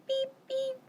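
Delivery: high, quiet little horn beep; soft squeaky beep. Acted. Teacher at the story's end, soft high beep.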